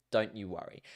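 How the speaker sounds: ordinary talk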